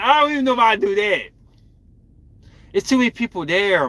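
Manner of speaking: high, loud protest; incredulous balking. Real, not acted. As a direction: balk at the idea, pitch jumping up. Balks at crying at wedding altar.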